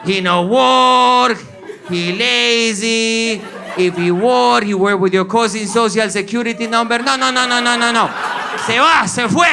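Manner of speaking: high, very drawn-out mock complaint; complaining immigrant cousin. Cousins denouncing Julian, hammed-up indignation. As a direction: stretch each accusation out in broken English.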